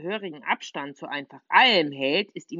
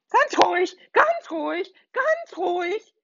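put-on high, louder calling to calm the kids; 4 dB louder than usual. Little witch calling to panicking children, high and loud. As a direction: call out soothingly over the chaos. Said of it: "ruft die kleine Hexe".

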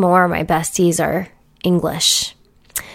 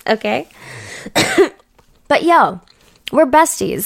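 ordinary talk

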